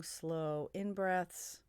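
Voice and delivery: usual speaking voice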